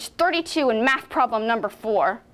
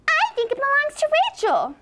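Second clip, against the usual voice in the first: Sylvia's taunt; 9 semitones higher than usual. Retold or imitated. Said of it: "that stupid Sylvia Saldivar says".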